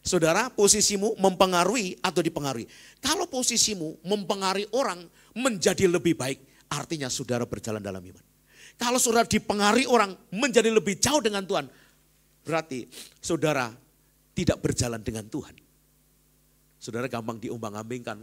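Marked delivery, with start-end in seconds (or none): 0.1-2.7 repeated louder for the congregation. Shown as a demonstration. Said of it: "Ayo, ini". 3.0-15.6 loud, high preaching climax; fiery sermon peak; preaching at full force. Acted. Preacher raises voice.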